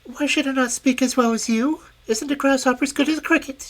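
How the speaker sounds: a cheeky tiny grasshopper; lightly squeaky grasshopper princess; little grasshopper. Acted. Grasshopper voiced in story.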